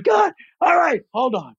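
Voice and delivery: high-pitched mock exasperated cry; exasperated outburst. Voiceover guy interrupted mid-chaos in the booth, acted exasperation. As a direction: burst out high, then rein it in.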